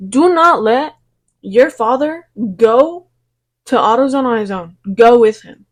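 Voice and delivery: loud, slowed warning to listeners; emphatic warning. Real, not acted. Vlogger warns viewers about creepy men, loud and emphatic. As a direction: drive the warning home, loud.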